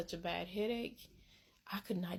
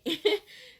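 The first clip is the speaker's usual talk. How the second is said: a squealing laugh, loud and high; high burst of laughter; 12 semitones higher, 6 dB louder than usual. Real, not acted. Telling friends about the blanket, squealing laugh.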